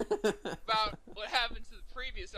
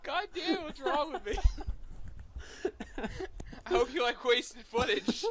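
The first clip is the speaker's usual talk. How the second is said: laughing; 8 semitones higher, 4 dB louder than usual. Real, not acted. Can't stop laughing during countdown.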